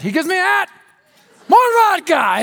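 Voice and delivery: high, loud drunken-guy exclamation, acted; delighted big guy. Big vodka drinker to the plane about his gift, crowing.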